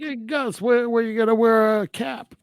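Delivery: high-pitched mock complaint to Gus; mock customer call. Imitating a diner calling the cook over about hair, high mock complaint.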